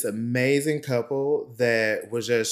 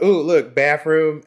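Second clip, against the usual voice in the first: excited discovery; 4 semitones higher, 7 dB louder, slower than usual. Retold or imitated. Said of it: "I just was like".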